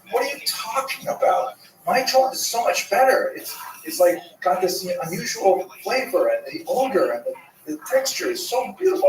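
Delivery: put-on high, protesting young daughter voice; indignant daughter. Daughter insisting to her mother, high and indignant.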